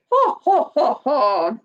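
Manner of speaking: hammed-up ho ho laugh; mock laugh. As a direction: play a jolly laugh. Laughs at own joke.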